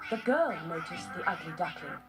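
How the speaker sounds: plain reading voice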